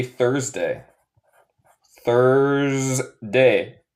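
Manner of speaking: Thursday as "thurz-day", drawn out; slow model pronunciation. Shown as a demonstration. Teacher shows how jueves is said, slow model pronunciation. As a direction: stretch "Thursday" into two syllables. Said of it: "se dice".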